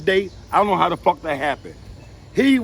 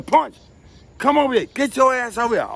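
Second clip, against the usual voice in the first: calling from a window; 6 semitones higher than usual. Retold or imitated. Sister and girlfriends yell from the window for Punch to come in.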